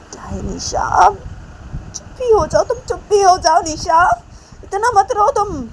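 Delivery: high-pitched, mounting frustration; exasperated scolding. A narrator's reading. Mother fed up with Nisha's crying, exasperated. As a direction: build to a loud order to stop crying.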